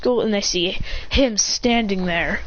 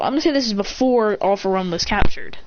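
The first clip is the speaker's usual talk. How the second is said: ordinary delivery, nothing marked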